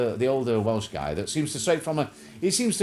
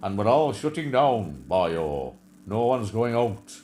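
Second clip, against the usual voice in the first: put-on slow, louder Welsh lilt, boyo style; mocking the Welsh minister's lockdown speech in a slow, louder Welsh accent; 3 dB louder, slower than usual. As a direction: imitate the gloomy Welsh leader in a Welsh accent. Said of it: "And says".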